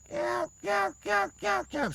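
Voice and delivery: high yelping hen turkey calls, voiced; turkey yelp imitation. Shown as a demonstration. Hunting teacher shows students the yelp rhythm, high turkey yelps. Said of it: "You're gonna go".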